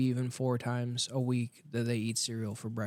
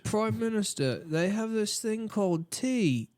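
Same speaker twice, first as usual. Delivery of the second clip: high-pitched Brit reporting a discovery; put-on colonial scout; mock British underling; 8 semitones higher than usual. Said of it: "'cause they were like".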